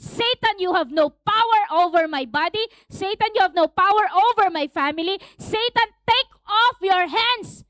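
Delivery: loud, high, commanding rebuke; forceful preacher's warfare prayer; strong conviction. Shown as a demonstration. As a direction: order the enemy away with full authority. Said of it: "with strong conviction".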